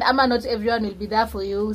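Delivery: ordinary delivery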